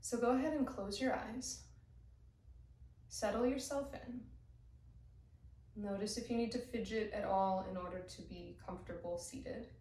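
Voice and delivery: calm, unhurried meditation guide; guided meditation. Acted. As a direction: guide them gently into the breath. Leads a breathing meditation.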